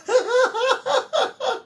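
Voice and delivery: high-pitched giggling burst; real high laugh. Real, not acted. Host amused by Ate Marie's comment, high giggling laugh. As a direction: let out a rapid, very high laugh.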